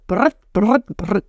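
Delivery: trilled R bursts, like a little motor; rolled R. Shown as a demonstration. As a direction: roll the R hard in "Brrrt". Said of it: "ça roule les R".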